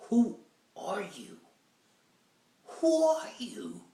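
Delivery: high, slow, stunned whisper; stunned disbelief. Retold or imitated. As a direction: ask it in hushed shock. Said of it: "and you like".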